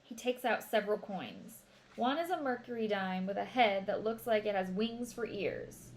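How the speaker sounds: ordinary delivery, nothing marked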